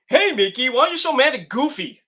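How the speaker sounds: playing the nosy peacemaker; mock announcer. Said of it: "He's like".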